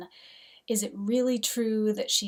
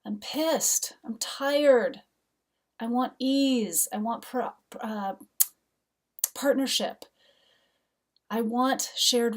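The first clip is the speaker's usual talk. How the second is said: higher and louder, letting it out; venting frustration; 3 semitones higher, 4 dB louder than usual. Real, not acted. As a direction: vent the anger that's coursing through. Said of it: "express and feel the energy".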